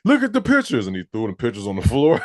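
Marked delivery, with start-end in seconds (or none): 0.0-0.9 high shouted impression of a movie character; mock angry character. Friend retelling a movie scene, high shouted character voice. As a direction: act out the furious scene at full pitch.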